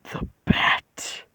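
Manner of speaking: slow, hushed whisper with long pauses; creature voice. Acted. Creature promises Zuko power as the Bat, whispered creature voice. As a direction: whisper it as the creature inside his head. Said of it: "creature says".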